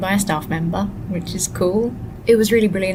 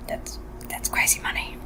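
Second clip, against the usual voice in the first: whisper; 8 dB quieter than usual. Real, not acted. Muttering about pasta prices.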